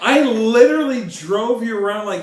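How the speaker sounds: high, drawn-out exasperated protest; mock-indignant rant. Real, not acted. Reminding partner of the car-buying favour, mock-indignant.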